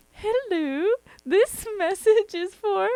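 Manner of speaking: high, put-on phone voice; prank caller voice. Daughter imitates mom's prank voicemail, put-on high voice. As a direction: snap into a mock caller character. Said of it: "snaps right into it".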